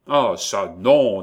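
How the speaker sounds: loud exasperated refusal; acted burst of exasperation. A narrator's reading. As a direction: refuse with fed-up force. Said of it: "dit Jacques, exaspéré".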